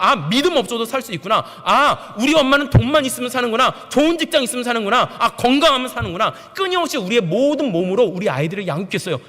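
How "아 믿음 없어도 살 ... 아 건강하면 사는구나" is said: put-on loud, high, half-laughing child's thoughts; child's mocking realization. Preacher voicing a child who sees through parents, loud and laughing. Child's conclusions voiced in sermon.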